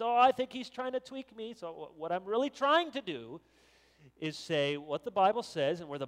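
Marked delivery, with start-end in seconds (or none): 0.0-1.5 high, louder mock suspicion; suspicious left-leaning listener. Listener on the left reacting to the preacher, mock suspicion. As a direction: act out an imagined listener, pitched up. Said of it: "there'll be some things on the left".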